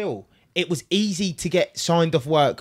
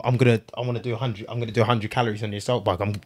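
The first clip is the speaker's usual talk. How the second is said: fast self-talk; 6 semitones lower, faster than usual. Retold or imitated. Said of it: "and I'm like".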